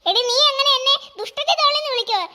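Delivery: put-on a stuck-up doll brushing the girl off; haughty doll. Doll haughtily refusing to play with the girl.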